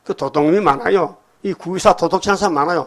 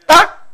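high, loud clap of a word; imitated whack; sharp imitated smack; 13 semitones higher, 7 dB louder, much slower than usual. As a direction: snap it out like a blow landing. Imitating a striking sound.

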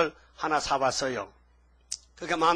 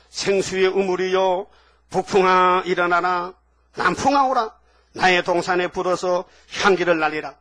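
preaching scripture; 5 dB louder than usual. Acted. Preacher reading God's love letter to the church, loud proclaiming. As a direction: proclaim the verses to the congregation. Reads scripture at full force.

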